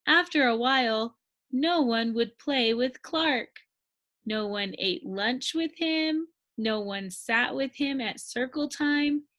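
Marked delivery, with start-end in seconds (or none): none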